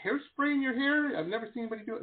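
incredulous at what you are seeing; baffled questioning. Retold or imitated. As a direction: retell it as a puzzled husband, voice lifted. Retold argument over hairspray in hair.

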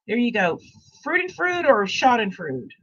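usual speaking voice

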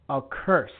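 usual speaking voice

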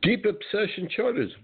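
squeaky high ad echo; high-pitched echo. Acted. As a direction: say it way up high, playful. Host echoes ad jingle-style.

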